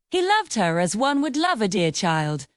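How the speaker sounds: usual speaking voice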